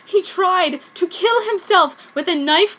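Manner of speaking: high, loud panicked cry; frantic. Acted. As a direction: blurt it out in panic. Frantic news.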